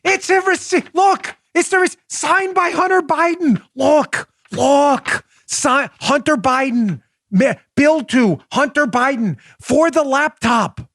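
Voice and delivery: high-pitched, stammering insistence; exasperated rebuttal. Acted. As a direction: make them look at the proof, exasperated. Exasperated rebuttal, pointing to proof.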